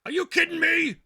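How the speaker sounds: very high, loud frustrated yell; exasperated shout. Real, not acted. Host yelling at a friend who won't pick up, exasperated shout. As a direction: blow up at the phone in disbelief.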